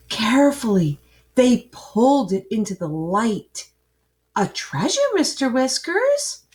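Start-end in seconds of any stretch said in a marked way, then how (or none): 4.4-6.5 eager rising question, wide-eyed; a child thrilled at a find; excited girl. Acted. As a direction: ask breathless with wonder. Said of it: "said Maggie with shining eyes".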